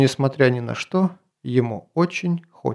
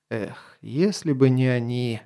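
ordinary delivery, nothing marked

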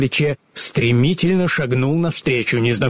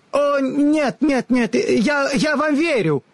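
very high, hurried protest; hasty and flustered; 14 semitones higher, slower than usual. A narrator's reading. The wizard hastily interrupts, flustered and high. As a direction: rush to interrupt in a panic. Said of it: "поспешно перебил голос".

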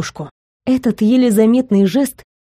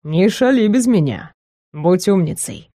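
teasing; 2 semitones lower than usual. A narrator's reading. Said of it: "насмешливо добавил".

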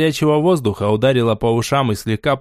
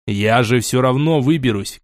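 ordinary delivery

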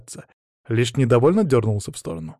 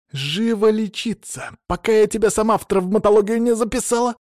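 high-pitched bossy threat; scolding medic, voice pushed high; threatening order; 11 semitones higher, 4 dB louder than usual. A narrator's reading. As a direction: order the boy off with a stern threat. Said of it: "она на угрозы перешла".

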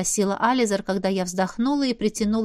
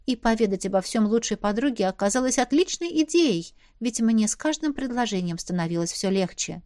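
ordinary delivery

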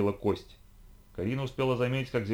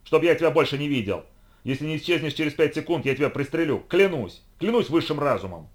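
harsh barking commands; 5 semitones higher, 6 dB louder than usual. Acted. Hunter still yelling threats.

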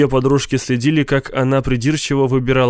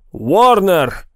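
higher-pitched roll-call shout; calling out a name; 6 semitones higher, 3 dB louder than usual. A narrator's reading. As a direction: call the next name to a crowd. Official calls out lottery names.

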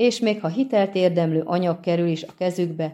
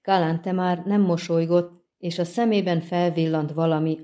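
ordinary delivery, nothing marked

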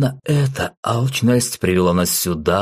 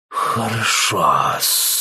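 very slow snake hiss; hissing serpent; 2 semitones higher, much slower than usual. Acted. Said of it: "прошипела одноглазая Нага".

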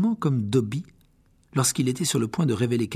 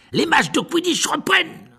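rugir, surexcité, voix haute; rugissement surexcité; 6 dB louder than usual. Acted. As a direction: hurler la nouvelle, fou de joie. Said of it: "rugit Dubois surexcité". (rugissement surexcité)

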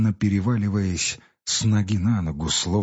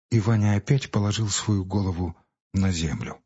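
usual speaking voice

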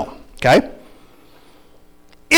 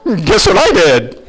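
ordinary talk, nothing marked

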